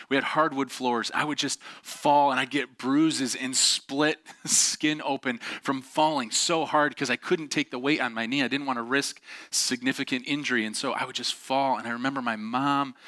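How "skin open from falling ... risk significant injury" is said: ordinary talk, nothing marked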